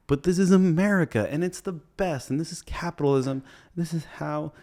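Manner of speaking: high, grand sarcastic declaration; hammed-up ode to capitalism; mock patriotic. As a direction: proclaim it like an anthem, tongue in cheek.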